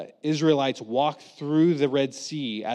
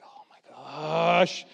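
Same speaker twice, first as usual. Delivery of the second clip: exasperated; 2 semitones higher, much slower than usual. Retold or imitated. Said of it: "I can just picture Moses like".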